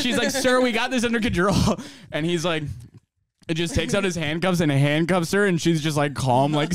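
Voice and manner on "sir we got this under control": higher-pitched imitation of confident staff; self-assured staffer. Veteran staff to arriving cop, imitated assurance. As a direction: act out waving off an officer.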